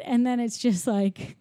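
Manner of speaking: ordinary talk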